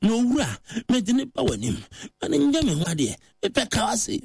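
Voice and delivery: high-pitched, emphatic mock prayer; acting out an insistent petitioner. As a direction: plead the request point by point, pitched right up. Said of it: "ɔbɛyɛ very emphatic".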